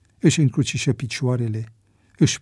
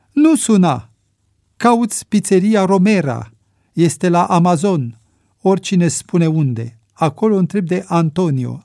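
louder, higher lightly South American orders; 6 semitones higher, 4 dB louder than usual. A narrator's reading. Vicente giving Ricky firm instructions, lightly South American. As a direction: snap out instructions with a hint of South American accent. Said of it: "o voce răgușită într-o engleză sud-americană".

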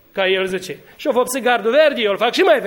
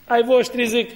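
ordinary talk, nothing marked

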